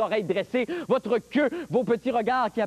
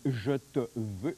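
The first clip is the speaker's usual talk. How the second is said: breathy lovesick plea; 10 semitones lower, 6 dB quieter, much slower than usual. Acted. Begging lovesick.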